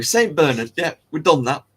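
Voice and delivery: usual speaking voice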